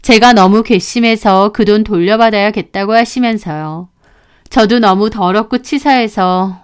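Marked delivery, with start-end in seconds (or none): none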